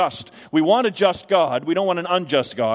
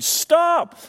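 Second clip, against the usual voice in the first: desperate shout; 11 semitones higher than usual. Retold or imitated. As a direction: shout in tears at cars rushing past. Officer throwing cones at cars.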